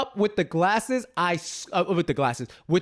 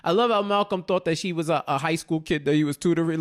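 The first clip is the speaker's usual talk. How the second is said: ordinary talk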